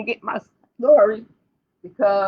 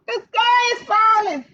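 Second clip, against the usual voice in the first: ordinary delivery, nothing marked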